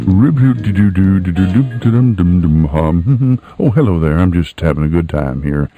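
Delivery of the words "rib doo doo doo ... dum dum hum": ordinary delivery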